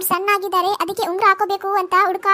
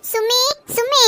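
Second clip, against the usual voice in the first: slow, higher, tearful calling out; 5 semitones higher, slower than usual. Acted. Mother-in-law tearfully calls her daughter-in-law, slow and higher. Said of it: "ಯಾಕ್ ಕಳ್ತಿದ್ದೀರಾ?".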